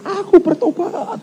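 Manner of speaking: very high, drawn-out mock cry; imitated scream. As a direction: wail it out slowly in falsetto, imitating. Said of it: "dia teriak".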